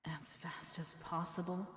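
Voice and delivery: slow whisper to herself; whispered aside. Acted. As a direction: whisper it under your breath.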